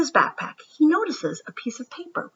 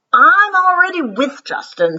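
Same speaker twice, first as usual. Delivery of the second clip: put-on higher, louder kid voice; child voice, brushing off; 10 semitones higher, 7 dB louder than usual. Emilio turning Brian away from the group, higher and louder. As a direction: shut him out matter-of-factly.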